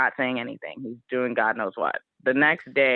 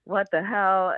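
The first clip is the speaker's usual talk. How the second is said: retold anger; 6 semitones higher than usual. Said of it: "I get so mad".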